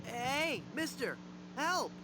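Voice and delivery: high, pleading cry for help; desperate call from inside the crypt. Acted. Trapped voice calling from crypt.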